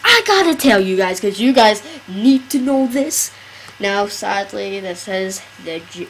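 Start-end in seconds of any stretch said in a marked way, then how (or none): 0.0-3.3 excited. Retold or imitated. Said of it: "I was like".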